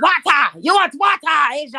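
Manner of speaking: put-on loud, high Nigerian-accented teasing; hammed-up Nigerian accent; mocking Nigerian accent. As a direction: shout "water" as WAH-TAH in a mock Nigerian accent. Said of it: "they'd be making fun of me".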